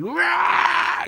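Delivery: a sudden high whooping yell; drunken roar. Retold or imitated. Retelling a drunk night out to his cohost, one wild whoop. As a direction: let out a wild drunk cheer.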